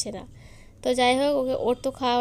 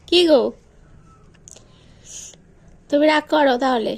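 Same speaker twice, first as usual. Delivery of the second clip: pleading scolding; 4 semitones higher, 6 dB louder than usual. Real, not acted. Sick mother pleading with child. (জোরে অনুনয় মেশানো বকুনি)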